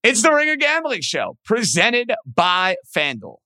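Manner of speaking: upbeat show-open announcer read; announcer intro. Acted.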